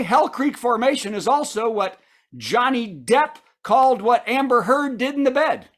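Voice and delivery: ordinary delivery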